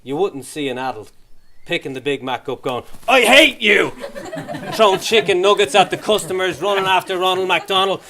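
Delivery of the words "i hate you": put-on shouted childish tantrum, much higher and louder; mock tantrum yell. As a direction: yell it like a furious toddler. Adult raging at McDonald's.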